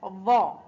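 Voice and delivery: ظا with the tongue tip out between the teeth. Shown as a demonstration. Teacher showing tongue placement to learners, ظا as interdental. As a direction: push the tongue out for an emphatic ظا. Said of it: "لا بد من إخراج اللسان عند النطق بها".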